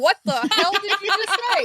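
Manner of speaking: high-pitched disbelief; incredulous, pitch jumping up; baffled outburst. Retold or imitated. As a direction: blurt it out, totally thrown. Acted baffled reaction to the gibberish.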